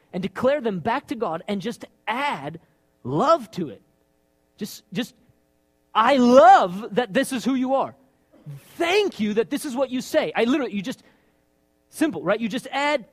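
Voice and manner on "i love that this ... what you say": sincere thanksgiving to God; worship demo. Shown as a demonstration. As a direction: pray it out with love. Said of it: "demonstrating worship declaration".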